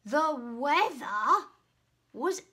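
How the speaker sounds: a hint of a grumbling dragon; puffing. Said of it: "he puffed".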